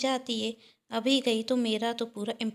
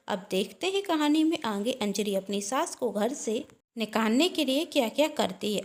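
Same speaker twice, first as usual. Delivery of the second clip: ordinary delivery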